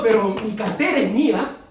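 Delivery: mock stingy believer; possessive insistence. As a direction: say "mi cartera es mía" as if hugging a wallet. Believer refusing to give money.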